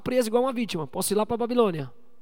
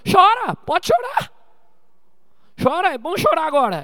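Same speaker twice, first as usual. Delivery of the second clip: put-on calling out to kids; 7 semitones higher, 5 dB louder than usual. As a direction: stretch it out loud to crying children. (gritando alto e devagar às crianças que perderam)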